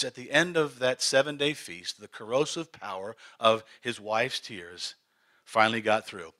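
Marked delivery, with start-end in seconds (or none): none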